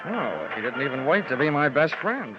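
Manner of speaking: ordinary talk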